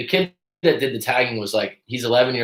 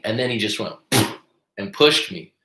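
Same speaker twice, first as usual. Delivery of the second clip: ordinary talk, nothing marked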